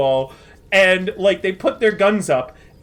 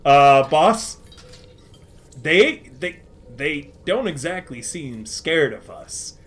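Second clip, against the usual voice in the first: lower, slower timid henchman; 9 semitones lower, slower than usual. Acted. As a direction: mumble it to the boss, unsure. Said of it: "one of the Devil's Cavalry goes".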